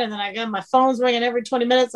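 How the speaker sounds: usual speaking voice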